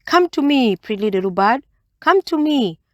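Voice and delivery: a hint of a wheedling spider calling the bird; coaxing Anansi. A narrator's reading. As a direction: sweet-talk the bird closer, lightly voiced. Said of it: "Anansi kept saying".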